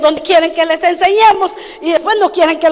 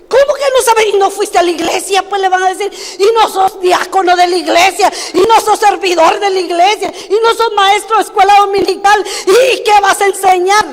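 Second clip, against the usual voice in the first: put-on challenging child; 4 semitones higher than usual. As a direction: grill the adult like an indignant kid. Grandchild challenging the adult.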